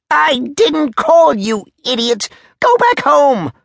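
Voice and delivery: high, loud, drawn-out yelling; furious Major. Acted. Major sending the soldier home, furious and shrieking. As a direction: yell him away, stretching the words.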